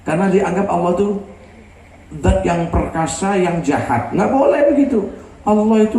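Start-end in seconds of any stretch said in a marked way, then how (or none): none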